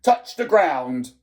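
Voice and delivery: loud, stern command; curt headmaster's order; snapped order. A narrator's reading. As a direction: bark it like a strict headmaster. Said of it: "Mister Coombs snapped out".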